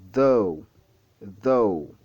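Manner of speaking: "though" with voiced TH and long falling vowel; voiced TH demo. Shown as a demonstration. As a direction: say "though" slowly and deliberately, tongue at upper teeth. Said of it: "le T H est prononcé différemment ici".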